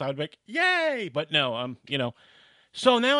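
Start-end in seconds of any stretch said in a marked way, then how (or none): 0.5-1.1 high, loud, gleeful yay; childish yay. Retold or imitated. Little boy cheering that Santa is real. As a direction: squeal it like a thrilled kid.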